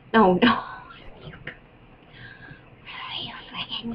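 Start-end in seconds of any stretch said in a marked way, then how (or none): 1.2-3.9 slow exasperated whisper. Real, not acted. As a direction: hiss it slowly under breath. Frustrated at slow character.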